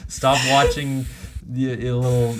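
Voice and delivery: usual speaking voice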